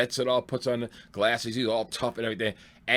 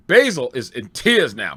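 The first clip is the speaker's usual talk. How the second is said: ordinary talk, nothing marked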